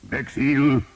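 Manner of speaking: high battle shout; desperate knight's shout. A narrator's reading. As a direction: call out his name loud across the fray. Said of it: "Sir Guillaume shouted".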